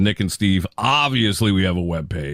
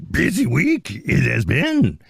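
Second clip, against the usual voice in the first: slow, higher Yoda croak; 5 semitones higher, slower than usual. Shown as a demonstration. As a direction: croak it like the little Jedi master. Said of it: "I'm speaking like Yoda today".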